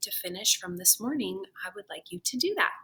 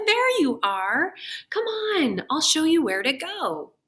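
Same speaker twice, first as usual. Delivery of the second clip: bubbly principal; 7 semitones higher, 5 dB louder than usual. A narrator's reading. Principal welcoming Sarah at the car, high and eager. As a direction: sweep her in with enthusiasm. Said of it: "principal greets Sarah".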